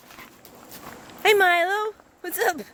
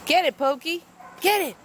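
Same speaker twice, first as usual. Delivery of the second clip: ordinary delivery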